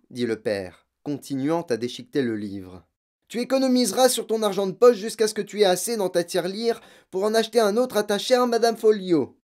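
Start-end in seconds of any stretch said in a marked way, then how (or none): 3.3-9.3 high, loud sneering command; mocking order. Father mocking his daughter's librarian, loud sneering order. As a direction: sneer as you order her.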